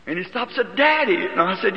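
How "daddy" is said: put-on child calling. Small son calls father.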